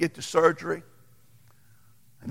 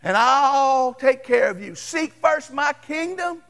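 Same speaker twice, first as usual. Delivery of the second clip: preaching at full force; 9 semitones higher, 4 dB louder than usual. Acted. Pastor preaching Jesus' promise to the congregation, full-force preaching. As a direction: build it up like a revival preacher. Preaching climax over music.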